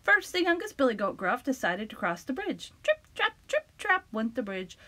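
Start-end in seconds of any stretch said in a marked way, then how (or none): 2.8-4.1 high trip trap. A narrator's reading. Bridge sound, high.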